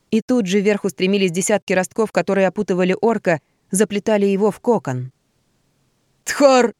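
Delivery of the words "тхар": high loud howl; a bound beast howls out; howling roar. Acted. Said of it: "взвыл было он".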